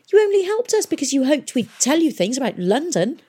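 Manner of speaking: high, loud accusing outburst; angry. Acted. Tom accuses Miss Fang of spying, furious and loud. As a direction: hurl it at her like an accusation. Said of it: "he said angrily".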